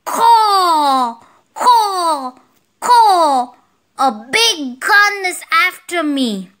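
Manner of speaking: high, wailing crow caws; high-pitched crow in alarm; scared crow. Acted. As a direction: cry out like a frightened crow. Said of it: "The crow got scared".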